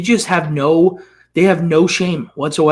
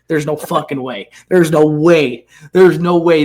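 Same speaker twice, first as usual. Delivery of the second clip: normal talking voice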